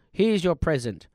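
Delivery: ordinary delivery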